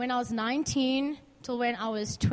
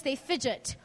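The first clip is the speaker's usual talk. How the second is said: ordinary talk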